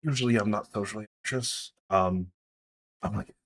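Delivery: softly hesitant, anxious answer; understated social unease; nervous character. Acted. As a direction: answer haltingly and quietly, a touch nervous. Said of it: "I'm nervous".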